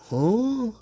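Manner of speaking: mock-baffled high grunt; a mock clueless huh; confused Piper Niven. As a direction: play her as lost and puzzled. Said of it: "she's like".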